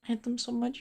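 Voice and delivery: ordinary talk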